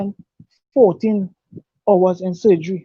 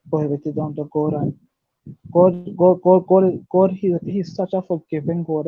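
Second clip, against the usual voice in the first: ordinary delivery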